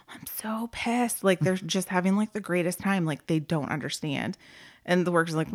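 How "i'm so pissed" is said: slow, hushed whisper, seething; whispered anger. Retold or imitated. Worker venting to coworker in the office, slow angry whisper. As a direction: confide your fury under your breath, slowly. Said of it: "was like".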